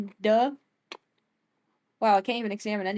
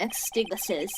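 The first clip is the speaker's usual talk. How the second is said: ordinary delivery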